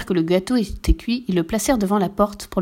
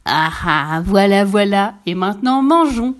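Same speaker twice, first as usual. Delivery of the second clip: louder, gloating mean dog; 4 dB louder than usual. A narrator's reading. The mean dog about to gobble the cake, loud. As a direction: pounce on the cake with greedy glee. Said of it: "Le méchant chien se jeta".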